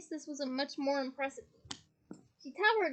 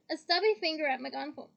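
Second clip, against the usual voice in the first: usual speaking voice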